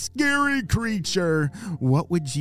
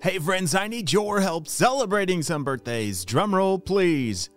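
put-on kids show host; 3 semitones higher than usual. Birthday shout-out segment.

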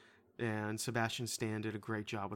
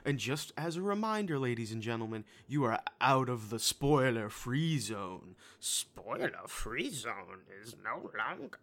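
enthusiastic announcer; 3 dB louder than usual. Pretend. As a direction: announce it grandly for the fans. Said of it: "I'm being enthusiastic".

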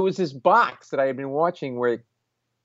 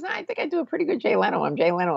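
much higher, fast helium-thin celebrity voice; Jay Leno voice; 12 semitones higher, faster than usual. Retold or imitated. Leno on the phone insisting it's really him, high and fast. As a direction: squeeze it up high and rattle it off. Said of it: "Nah, the voice is not right".

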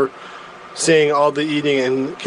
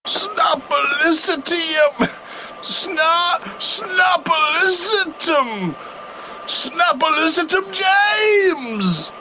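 shouting catchphrase; 11 semitones higher, slower than usual. Acted.

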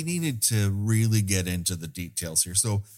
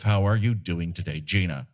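ordinary talk

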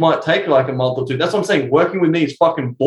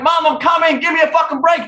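put-on higher, raised voice of a flustered boy; stressed teen shout; 10 semitones higher than usual. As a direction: yell back at mum, hurried. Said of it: "I'm like".

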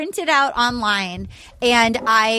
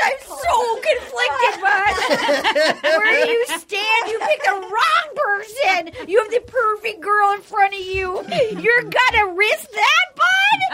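weeping mom; 6 semitones higher than usual. Retold or imitated. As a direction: wail it out like a distraught mother. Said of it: "then she goes".

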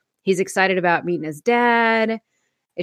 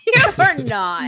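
laughing disbelief; 8 semitones higher, 3 dB louder, slower than usual. Retold or imitated. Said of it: "and people go".

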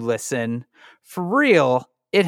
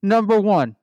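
Rudy Giuliani voice; 3 semitones higher, slower than usual. Pretend. Giuliani says password.

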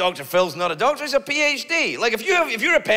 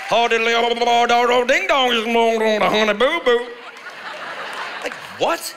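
put-on loud, garbled yodelling Southern accent parody; mocked Honey Boo Boo accent; 3 semitones higher, 3 dB louder than usual. Comic mimicking people on Honey Boo Boo, loud garbled accent. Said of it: "sound like they're yodeling underwater".

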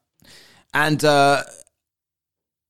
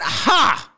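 high, loud single scoff; mock scoffing ha; 3 dB louder than usual. Man turning on a woman who offered help, mock scoff. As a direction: throw out one derisive high "ha".

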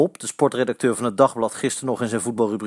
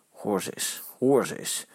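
soft, scornful muttered remark; 3 semitones lower, 6 dB quieter than usual. A narrator's reading. Eddy scoffing at the rival fans, soft scorn. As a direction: sneer at the cheering rivals under your breath. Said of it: "zegt Eddy schamper".

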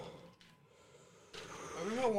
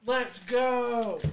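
cheering; 5 semitones higher, 7 dB louder than usual. Real, not acted. Cheering the game.

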